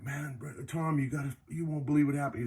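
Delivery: high, breathless rush of news; telling Tom about the sighting, high and excited; excited teen blurt. Retold or imitated. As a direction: blurt it out like a shaken kid. Teens rushing to tell Tom.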